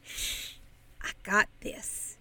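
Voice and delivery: soft unvoiced whisper; breathy whispered shush; whispered title. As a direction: hush it like a secret. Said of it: "in a whisper".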